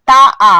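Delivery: তা আ with the আ held out loud; drawn vowel. Shown as a demonstration. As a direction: stretch তা into আ to show the open vowel. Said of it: "তা এর শেষেও স্বরধ্বনি আছে". (তা-র আ টেনে বলা)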